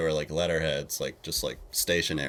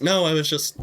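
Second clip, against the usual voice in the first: higher, louder flustered protest; 10 semitones higher, 5 dB louder than usual. Retold or imitated. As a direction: caught off guard and stammering. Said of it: "I was like".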